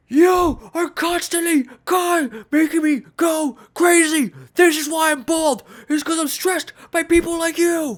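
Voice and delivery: high, loud hammed-up tantrum; Anthony Fantano ranting. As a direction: yell accusingly, making a scene. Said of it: "making a scene".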